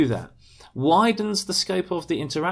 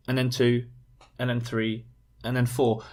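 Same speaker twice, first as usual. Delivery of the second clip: disjointed listing; 2 semitones lower than usual. Shown as a demonstration. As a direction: plod through each item on its own. Mimics disjointed listing of points.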